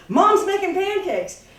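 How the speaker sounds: put-on eager child calling out; excited call. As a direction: burst in announcing breakfast. Kid bursts in calling out.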